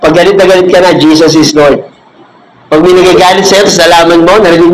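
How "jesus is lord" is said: calm low declaration. Shown as a demonstration. Modeling calm reply when angry.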